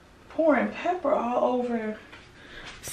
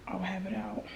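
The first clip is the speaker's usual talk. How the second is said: soft breathy whisper; 16 dB quieter than usual. Real, not acted. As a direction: barely breathe the words. Hushed aside.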